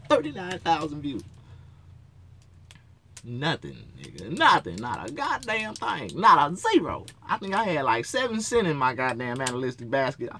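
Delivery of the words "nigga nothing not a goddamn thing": usual speaking voice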